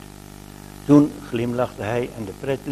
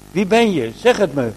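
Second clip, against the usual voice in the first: urgent call, higher and louder; 6 semitones higher, 5 dB louder than usual. A narrator's reading. Old shoemaker begs the visitors to say who they are, calling out. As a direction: demand an answer from the voice. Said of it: "riep de oude schoenmaker".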